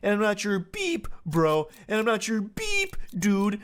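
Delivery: high held tone on each beep; mock-read the rant, bleeping the curses; censor beep. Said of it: "He's literally beeping himself with a sensor".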